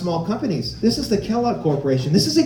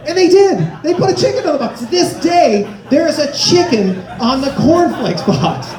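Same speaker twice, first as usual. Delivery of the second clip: high, loud, laughing exclamation; laughing outrage; 8 semitones higher, 4 dB louder than usual. Real, not acted. Comic laughing at the chicken on the cornflakes box, loud outrage. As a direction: shout it through laughter in disbelief. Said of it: "laughing outrage".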